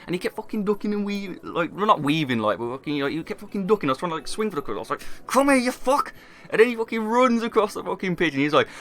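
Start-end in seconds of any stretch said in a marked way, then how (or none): 5.3-6.1 high, raised-voice chasing threat; retold furious summons; angry call. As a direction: yell after someone dodging your punches. Acted chase during retold fight.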